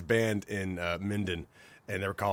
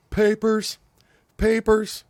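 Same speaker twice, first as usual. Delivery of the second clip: obnoxious calling out; 12 semitones higher, 5 dB louder, slower than usual. Retold or imitated. Obnoxious guy calling out in bar.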